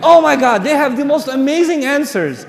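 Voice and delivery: loud, high gushing excitement; over-the-top thrilled outburst; mock gushing. Mock gushing before a scream.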